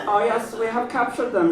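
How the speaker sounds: put-on high, hammy German-accented villain; slight German accent. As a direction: play a sneaky German scientist. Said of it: "Just make him a little German".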